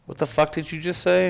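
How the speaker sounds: startled disbelief. Retold or imitated. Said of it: "And I'm like".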